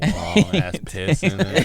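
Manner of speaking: loud, slow, through laughter; laughing. Real, not acted. Friend joking about the watering hole, laughing. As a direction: crack up while saying it.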